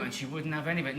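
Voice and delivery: normal talking voice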